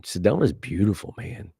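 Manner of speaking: ordinary delivery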